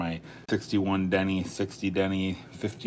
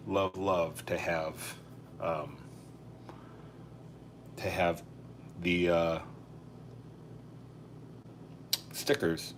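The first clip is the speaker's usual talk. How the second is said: real hesitant searching, with long pauses; stuck mid-sentence, filling gaps with um and uh; halting stumble; 2 semitones lower, 7 dB quieter, slower than usual. Real, not acted. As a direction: stall and restart as you hunt for words. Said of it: "why I'm stuttering right now".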